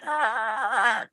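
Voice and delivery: very high squeaky dust mite cry; tiny creature wail. Acted. Dust mites cry out, GM voices them.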